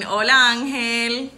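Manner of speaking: usual speaking voice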